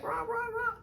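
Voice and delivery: high, soft yappy bark sounds; squeaky little barks; mock dog bark. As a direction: yap like the tiny dog. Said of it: "And she's like".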